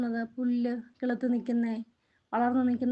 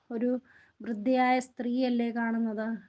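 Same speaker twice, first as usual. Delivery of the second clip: normal talking voice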